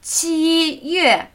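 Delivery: "qi" high and level, "yue" falling from high; first then fourth tone. Shown as a demonstration. Teacher showing the month July, high then falling tone. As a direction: hold "qi" up, then let "yue" drop. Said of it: "Der erste Ton und der fallende vierte".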